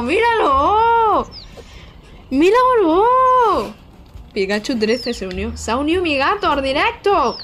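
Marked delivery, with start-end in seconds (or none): 0.0-3.9 high, loud, very slow stretched cries; squealing at the cat, drawn way out; ecstatic squeal. Real, not acted. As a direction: drag out each word in pure delight. Her cat joins the stream.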